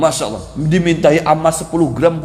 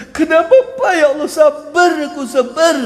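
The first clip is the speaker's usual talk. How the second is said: mock tearful complaint; 9 semitones higher than usual. Mourner claiming patience while crying.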